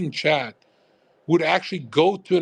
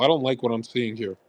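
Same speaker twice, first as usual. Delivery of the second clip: usual speaking voice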